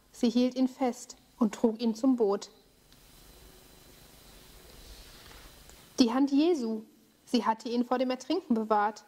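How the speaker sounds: calm storytelling voice; story narration for children. A narrator's reading. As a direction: tell the tale to kids gently. Bible story told to children.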